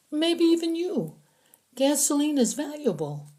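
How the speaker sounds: high, anxious captive voice; a scared prisoner explaining to his captors; frightened prisoner. Acted. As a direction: talk fast to save your skin.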